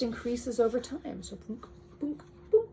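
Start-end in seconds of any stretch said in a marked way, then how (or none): none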